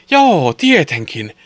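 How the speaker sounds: high, loud, drawn-out storybook yes; excited agreement. A narrator's reading. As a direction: cheer it slowly like eager boys. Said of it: "pojat vastasivat innoissaan". (innostunut, venytetty lasten huudahdus)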